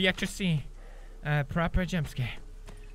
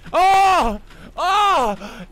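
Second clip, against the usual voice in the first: two loud, high drawn-out "oh"s; panicked cries; 10 semitones higher, 9 dB louder than usual. Real, not acted. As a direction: cry out in alarm, high and loud. Panicking as the game timer runs.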